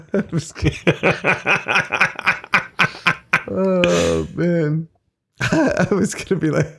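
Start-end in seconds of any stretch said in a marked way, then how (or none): 0.0-5.4 breaking up laughing mid-word; can't stop laughing. Real, not acted. Laughing fit.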